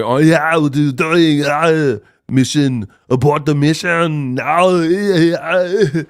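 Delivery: stretched, wailing death groans; hammed-up dying wail.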